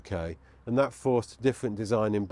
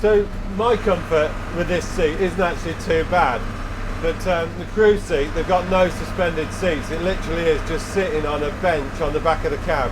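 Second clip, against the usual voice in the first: shouting over engine; 6 semitones higher than usual. Real, not acted. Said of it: "I'm having to raise my voice to, um, talk over the engine".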